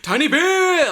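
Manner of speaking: put-on high, loud drunken cheer; gleeful re-enacted yell, held long; retold screaming. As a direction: scream it out like a toast. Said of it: "We just kept screaming".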